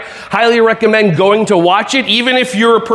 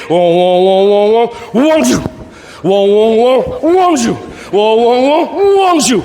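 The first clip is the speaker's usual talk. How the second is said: laser and dodging noises; 2 semitones higher, slower than usual. Pretend. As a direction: play both the laser and the dodger. Laser game sound effects.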